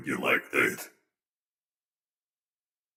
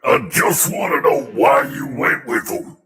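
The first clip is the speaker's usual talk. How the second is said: shouting; 10 semitones higher, 7 dB louder than usual. Acted. Angry confrontation, shouting.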